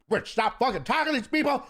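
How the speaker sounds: high-pitched, urgent mock scolding; exasperated partner. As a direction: imitate a partner cutting someone off. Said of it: "And my partner's like".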